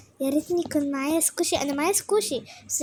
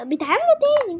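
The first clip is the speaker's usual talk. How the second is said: high, loud excited burst; 8 semitones higher, 7 dB louder than usual. Real, not acted. Gamer excitedly telling viewers the items refill. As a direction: blurt it out thrilled.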